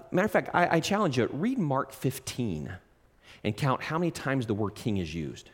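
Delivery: ordinary talk, nothing marked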